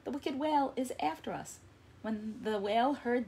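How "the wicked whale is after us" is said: calling out. Acted. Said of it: "he called".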